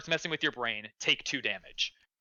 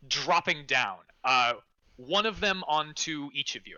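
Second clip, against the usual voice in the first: ordinary talk, nothing marked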